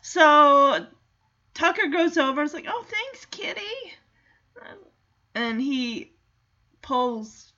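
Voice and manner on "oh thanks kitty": high, soft cooing thanks; grateful boy. Retold or imitated. Boy thanks the cat for the rescue, soft high gratitude. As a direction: lift the pitch and soften right down.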